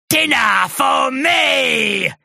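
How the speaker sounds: high, slow roaring shout; monster shout. A narrator's reading. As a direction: bellow it like a sea beast.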